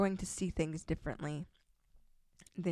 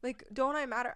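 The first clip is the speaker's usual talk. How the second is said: higher, fast whiny protest; playing the hurt girlfriend she avoids being; mock upset complaint; 6 semitones higher, faster than usual. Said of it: "instead of getting mad at him and being like".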